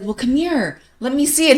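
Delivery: high mock mom voice; mom coaxing. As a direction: coax the hurt boy over. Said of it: "my mom was like".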